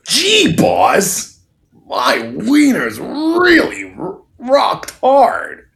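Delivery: high, strained dopey henchman voice. Retold or imitated. Henchman in bed to his wife, high and strained. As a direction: squeak it out like an eager sidekick. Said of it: "stay in character".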